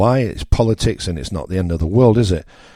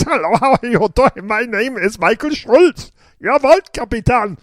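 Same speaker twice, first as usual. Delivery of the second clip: mock German accent, much higher. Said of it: "I would have been talking like this".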